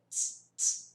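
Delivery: bare, voiceless "t", released with a puff; isolated T sound. Shown as a demonstration. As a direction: demonstrate "t" with no vowel after it. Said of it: "Esse é o som do T".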